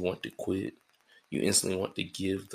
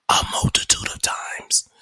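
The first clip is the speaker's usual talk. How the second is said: breathy stage whisper, as if sharing a secret; secretive whispered aside; 8 dB louder than usual. Acted. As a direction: drop to a whisper for the punch.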